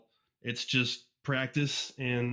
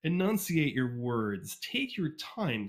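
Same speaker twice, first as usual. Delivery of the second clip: slow, careful enunciation; slower than usual. Shown as a demonstration. Coaching new fic readers, slow and clearly enunciated. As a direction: stretch each word out slowly. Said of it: "Slow down".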